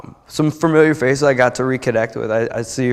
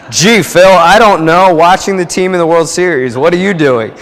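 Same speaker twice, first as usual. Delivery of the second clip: acting breezy and upbeat, higher and louder; mock casual cheer; 8 semitones higher, 10 dB louder than usual. Answering the boss on the phone, mock casual and upbeat. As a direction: play it innocent, bright and loud.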